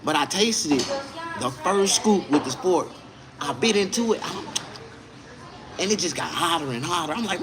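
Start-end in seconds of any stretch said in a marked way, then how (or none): none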